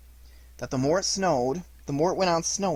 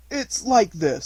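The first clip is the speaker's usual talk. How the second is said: raised voice, tentative start; Pooh explaining; 7 semitones higher, 5 dB louder, slower than usual. A narrator's reading. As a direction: try to begin an awkward explanation. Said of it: "he wasn't explaining very well".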